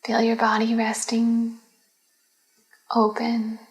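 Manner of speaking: unhurried, calm meditation voice; guided meditation. Acted. Leads a Yoga Nidra relaxation.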